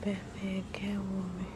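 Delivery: hushed whisper in the library; quiet whispered tour of the shelves. Real, not acted. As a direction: keep it down, whisper so nobody hears.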